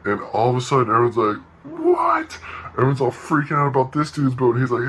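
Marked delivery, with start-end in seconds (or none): 1.6-2.4 very high squeaky "What?"; mock astonishment. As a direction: gasp it out in disbelief. Everyone astonished at the boat.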